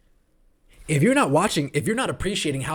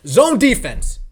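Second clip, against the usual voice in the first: louder, exasperated burst on zone defense; frustrated shout; 4 semitones higher, 8 dB louder, slower than usual. Real, not acted. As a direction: blurt it out in frustration. Ranting about zone defense.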